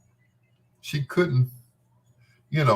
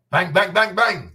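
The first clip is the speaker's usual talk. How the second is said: high, louder playful chant; excited bang bang; 9 semitones higher, 4 dB louder than usual. Real, not acted. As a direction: punch out each bang with glee. Praising singer's attractiveness.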